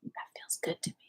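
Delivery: soft whispered affirmation; whispered yes. Pretend. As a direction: breathe it to yourself.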